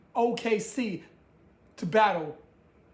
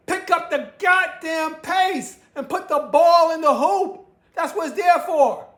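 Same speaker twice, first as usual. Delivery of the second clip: higher, louder mock shout at the teams; comic shout across to Orlando; calling out; 6 semitones higher, 6 dB louder than usual. Real, not acted. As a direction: yell out to the players like through a mic.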